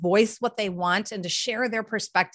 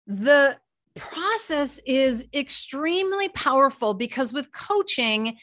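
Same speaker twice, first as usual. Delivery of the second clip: ordinary talk, nothing marked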